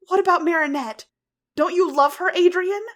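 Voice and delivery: desperate cry. A narrator's reading. Ladybug pleading with Cat Blanc, loud and desperate. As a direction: shout to reach him.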